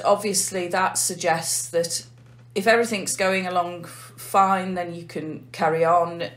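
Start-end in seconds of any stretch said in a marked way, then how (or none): none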